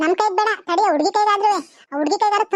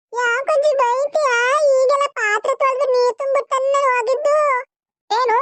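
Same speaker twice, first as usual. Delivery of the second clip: put-on high, drawn-out child's protest; 6 semitones higher than usual. Granddaughter objects to grandmother's scolding, protesting. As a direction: answer back in a high, slow whine.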